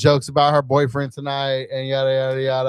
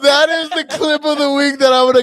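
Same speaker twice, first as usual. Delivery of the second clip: high, tickled exclamation; delighted uproar; 12 semitones higher, 5 dB louder than usual. Real, not acted. Host promising to clip co-host's attempt, high delighted voice. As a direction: crow it at a high pitch.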